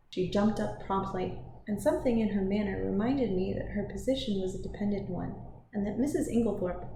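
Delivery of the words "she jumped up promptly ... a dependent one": plain reading voice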